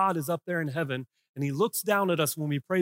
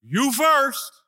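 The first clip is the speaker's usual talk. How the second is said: firm divine answer; 10 semitones higher, 7 dB louder than usual. Acted. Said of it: "And God says".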